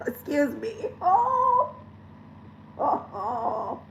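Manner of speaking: real sobbing, voice breaking; tearful gasps, high breaking oh; crying. Real, not acted. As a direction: cry through the words, catching breath. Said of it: "I am only crying".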